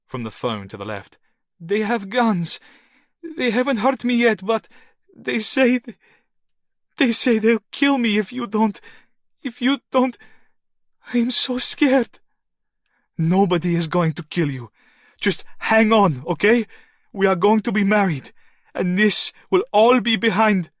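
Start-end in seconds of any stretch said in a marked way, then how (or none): 1.6-12.2 high, halting frightened voice; terrified captive on the phone. A narrator's reading. 13.2-20.7 high, loud desperate comfort; desperate man shouting comfort; frantic reassurance. A narrator's reading.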